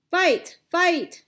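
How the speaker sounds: loud fight call, high; shouting. A narrator's reading. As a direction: yell it across a crowded courtyard. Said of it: "shouts filled the courtyard".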